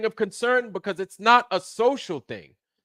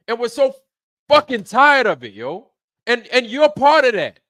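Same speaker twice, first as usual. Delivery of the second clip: real frustration, loud and higher-pitched; loud, exasperated rant; frustrated yelling; 4 semitones higher, 7 dB louder than usual. Real, not acted. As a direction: yell it out, fed up. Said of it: "why I'm so fucking frustrated".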